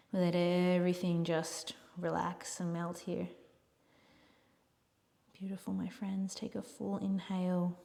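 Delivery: soft yoga teacher's breathing cues; softly spoken cues with long pauses; guided relaxation. Real, not acted. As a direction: guide them gently into rest.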